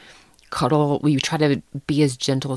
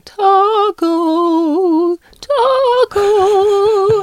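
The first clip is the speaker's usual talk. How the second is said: much higher, louder, drawn-out singsong call; 7 dB louder, much slower than usual. Retold or imitated. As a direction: sing the dog's name out across the street. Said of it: "the house sitter is going".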